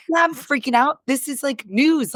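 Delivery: high, frazzled admission; half-laughing, high freak-out; mock panic. Admitting panic to husband.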